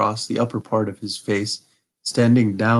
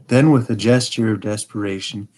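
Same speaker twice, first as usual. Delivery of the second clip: ordinary delivery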